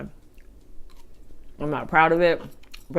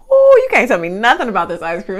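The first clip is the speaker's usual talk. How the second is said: excited; 5 semitones higher, 7 dB louder than usual. Real, not acted. Raving about ice cream.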